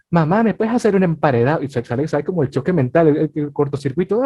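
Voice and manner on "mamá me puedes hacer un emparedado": put-on higher-pitched kid asking mom; lightly mimicked little nephew, pitched up; imitated child. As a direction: play a nephew pleading in dubbing Spanish. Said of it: "si tienes un sobrino y tal".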